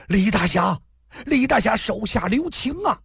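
high, pleading cry for mercy; anxious plea. A narrator's reading. Servant begging the hero to spare the boy, anxious high cry. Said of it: "焦急的呼声".

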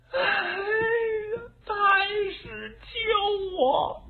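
high, sobbing cry for help, drawn out slowly; crying plea. Acted. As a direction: Diao Chan wails to the Grand Tutor, begging to be saved. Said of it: "貂蝉哭了".

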